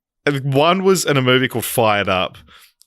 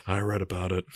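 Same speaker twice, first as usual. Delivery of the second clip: quoting the line; 4 semitones lower, 11 dB quieter than usual. Retold or imitated. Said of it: "the exact same line".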